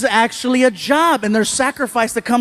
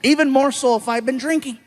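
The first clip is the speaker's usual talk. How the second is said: ordinary talk, nothing marked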